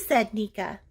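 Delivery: plain reading voice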